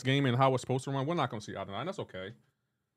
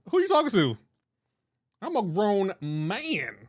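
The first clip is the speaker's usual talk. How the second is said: mock-stern dad, raised in pitch; 5 semitones higher, 4 dB louder, slower than usual. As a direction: play an indignant dad, dragging out the last words. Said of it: "use his dad voice on us".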